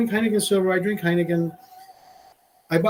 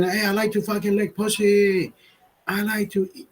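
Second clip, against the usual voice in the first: 1 mock boast; 4 semitones higher than usual. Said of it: "I don't go around saying".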